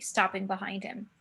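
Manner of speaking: ordinary delivery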